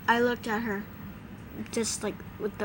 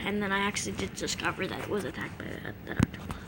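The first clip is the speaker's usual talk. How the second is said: quiet aside; 5 semitones lower, 9 dB quieter, faster than usual. Real, not acted.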